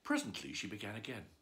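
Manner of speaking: ordinary delivery, nothing marked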